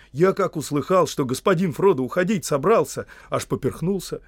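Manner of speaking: excitable gardener's excuse; making excuses. Acted. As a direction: justify yourself eagerly to a master. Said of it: "оправдывался Сэм".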